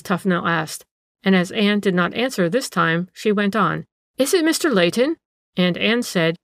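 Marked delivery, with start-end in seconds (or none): 4.2-5.2 high rising question; questioning call. A narrator's reading. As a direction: call the question down the stairs, pitched up. Aunt calls down to niece.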